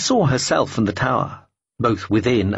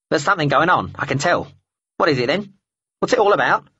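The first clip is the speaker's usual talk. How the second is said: higher, pushy bird voice rising to a squawk; nosy talking bird; 5 semitones higher, faster than usual. Acted. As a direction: pester her like a curious crow. Talking bird questions the woman.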